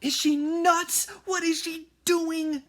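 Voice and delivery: horrified at what she's doing; alarmed disbelief. A narrator's reading. Jack panics watching Annie.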